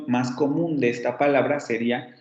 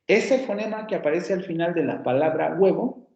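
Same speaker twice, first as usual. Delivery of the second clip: ordinary talk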